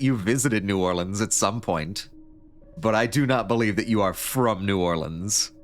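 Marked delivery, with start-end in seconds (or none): none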